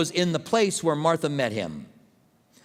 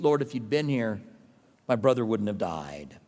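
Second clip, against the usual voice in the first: quiet, lower, grieving plea; great sorrow; 7 semitones lower, 3 dB quieter than usual. Retold or imitated. Grieving sister at Jesus' feet, quietly sorrowful. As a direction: voice Mary's sorrow at his feet. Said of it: "she says it in this great sorrow".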